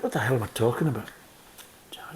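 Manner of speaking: normal talking voice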